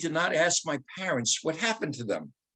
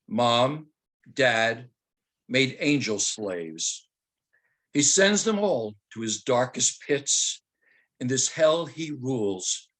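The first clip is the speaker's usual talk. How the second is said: slow, low and solemn poem reading; 3 semitones lower, slower than usual. Acted. Teacher opens his talk with his Holocaust poem, slow and solemn. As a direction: read the dark verse slowly, letting each line sink.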